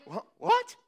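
stammered, high rising "what"; baffled disbelief. Acted. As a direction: squeak it out, utterly puzzled. Imagined servant's baffled reaction.

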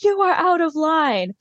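high-pitched mock scolding; hammed-up reprimand; Katie. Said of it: "Katie grabs Stassi's arm and is like".

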